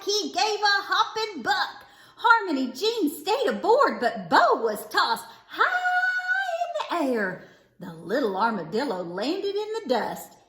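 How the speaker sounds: ordinary delivery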